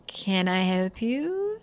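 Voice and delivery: drawn-out, measured polite question; slow, poised voice of the woman at the door; composed stranger. Retold or imitated. Woman answering the door to a stranger, composed.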